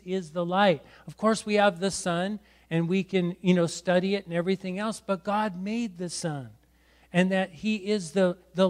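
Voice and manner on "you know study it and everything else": normal talking voice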